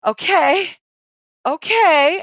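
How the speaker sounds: high, drawn-out doubtful okay; wary humouring. Retold or imitated. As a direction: imitate a partner warily playing along. Wife's wary reply to hearing voices.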